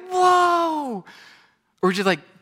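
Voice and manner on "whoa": high, loud drawn-out whoa; amazed whoa. Retold or imitated. Pastor mimics a congregation stunned by a miracle. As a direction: let the awe burst out.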